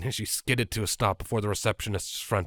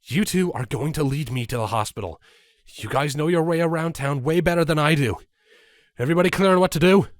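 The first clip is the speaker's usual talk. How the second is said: loud, higher, rushed question; urgent orders; 6 semitones higher, 5 dB louder, faster than usual. Acted. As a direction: push them to move now.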